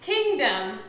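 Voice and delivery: ordinary talk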